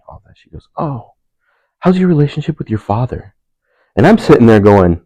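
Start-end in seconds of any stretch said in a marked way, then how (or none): 0.8-3.3 imitating the lady. Lady voiced in retold story.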